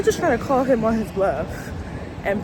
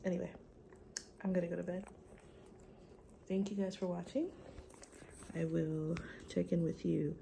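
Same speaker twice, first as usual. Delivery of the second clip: ordinary delivery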